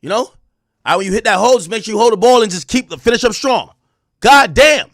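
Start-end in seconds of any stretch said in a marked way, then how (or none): 0.8-3.7 loud, higher-pitched football coach pep talk; rally the team, loud and urgent. Acted. Imitating [name] as a coach urging a player on, football coach pep talk. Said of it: "It's like a football coach". 4.2-4.9 loud, high exclamation of disbelief; amazed outburst. Real, not acted. Reacting to [name] coaching through the night, amazed outburst.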